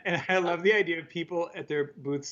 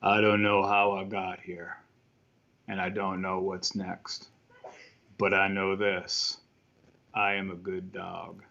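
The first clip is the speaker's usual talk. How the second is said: dog voiceover; 8 semitones lower than usual. Retold or imitated. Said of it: "They did a voiceover where it said".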